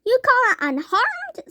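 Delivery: high indignant snap; indignant mother snapping. A narrator's reading. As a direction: throw it back at him. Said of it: "snapped Missus Teavee".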